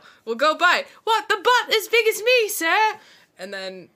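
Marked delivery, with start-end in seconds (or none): 0.0-0.9 loud, high, hammed-up order; over-the-top giddy Scrooge shout; mock Scrooge. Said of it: "then you'll be like". 1.0-2.9 very high, loud mock urchin; astonished little boy, hammed right up; Christmas Carol kid. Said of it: "at the end of Christmas Carol".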